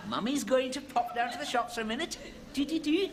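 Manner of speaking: put-on high, cooing old lady. Old lady talks to parrot.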